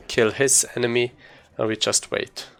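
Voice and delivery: normal talking voice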